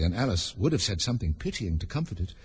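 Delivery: ordinary delivery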